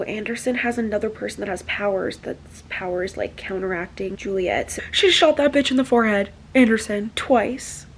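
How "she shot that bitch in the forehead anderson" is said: usual speaking voice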